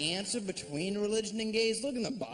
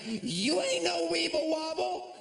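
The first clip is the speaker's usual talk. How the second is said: mock taunt; 11 semitones higher than usual. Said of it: "be like".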